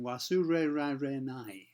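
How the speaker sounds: a foreigner saying "Wasure ra re nai", every R curled, slow; foreigner's English R. Retold or imitated. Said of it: "Imagine a foreigner saying".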